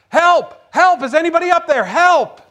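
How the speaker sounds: loud, high desperate cries; yelling for help. Acted. Man hanging from a cliff calls for rescue, yelling. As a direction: holler up for someone to save you. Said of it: "to yell for help".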